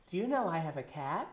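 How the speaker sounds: a hint of a small girl's voice; little girl. Little girl raising her hand in class, lightly imitated. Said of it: "a little girl raised her hand and said".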